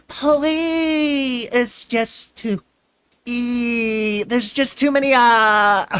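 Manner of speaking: high, loud, stretched-out filly whine. A narrator's reading. Filly whining at Twilight to let her come, whining. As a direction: wail it like a spoiled child. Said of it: "the filly's never-ending whine".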